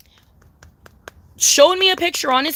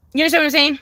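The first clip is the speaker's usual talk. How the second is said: ordinary delivery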